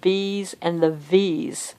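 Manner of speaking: "B's" and "V's" said slowly with the lip held by a finger. Shown as a demonstration. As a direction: finger pulling the lip aside while saying "B's and the V's". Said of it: "I can hold this and I can say".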